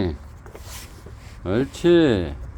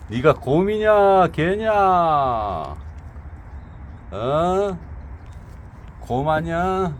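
teasing; 4 semitones higher, slower than usual. Real, not acted. Teasing the dog.

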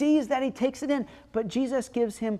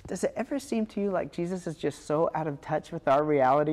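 normal talking voice

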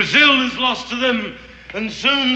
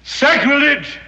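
outraged cry; 8 semitones higher, 4 dB louder than usual. Acted. Outraged reply to treasure plan.

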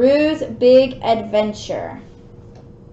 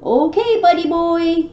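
higher, louder call across the woods; 5 semitones higher, 4 dB louder than usual. Acted. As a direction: call out to a hidden friend. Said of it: "he called".

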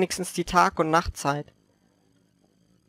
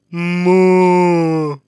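cow moo; 6 dB louder than usual. Retold or imitated. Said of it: "die Kuh macht dann einfach".

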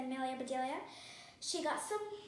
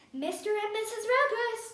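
loud, high summons; ringing storybook holler; calling out; 8 semitones higher, 9 dB louder than usual. A narrator's reading. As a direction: call people in from a distance. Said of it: "called Amelia Bedelia".

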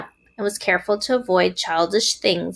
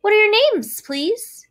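high, eager, louder opening; trembling with joy; 14 semitones higher, 5 dB louder than usual. A narrator's reading. Wilbur asks the new spiders' names, overjoyed. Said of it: "trembling with joy".

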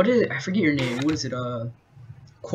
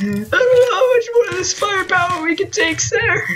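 high, crying frightened soldier; wounded soldier gasping; 12 semitones higher than usual. Pretend. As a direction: whimper it out under fire.

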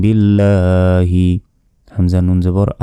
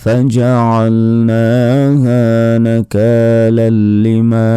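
Quran recitation; 2 semitones higher, much slower than usual. Acted. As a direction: chant the verse slowly and fully. Recites the verse after spelling. (ধীর সুরে কুরআন তিলাওয়াত)

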